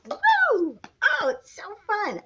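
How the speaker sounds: high, delighted whoop; excited cheer. Acted. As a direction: cheer like a kids' show host. Excited host for child.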